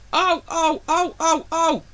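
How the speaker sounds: high squealing trucks voice; screaming trucks. A narrator's reading. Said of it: "screamed the trucks".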